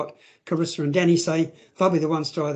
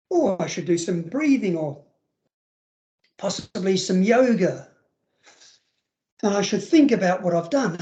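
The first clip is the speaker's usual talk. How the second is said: mock child, sarcastic; 2 semitones higher than usual. Child in the room mocking the carer's idea of calming down. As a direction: sarcastically pretend to be calm now. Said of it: "I act this out".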